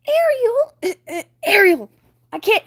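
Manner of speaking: high, loud panicky fish; scared Flounder. Acted. Flounder scared of sharks, high panicky voice. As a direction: fake bravado cracking into panic.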